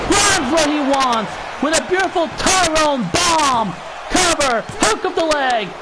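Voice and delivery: excited play-by-play call; wrestling commentator. Acted. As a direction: build up the big finish. Play-by-play climax.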